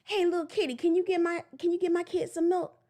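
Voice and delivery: high, loud coaxing goat voice; goat asking a favor. Pretend. Goat asking the kitty for milk, high coaxing call. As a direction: goat sweet-talking a kitty. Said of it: "you don't see that goat going".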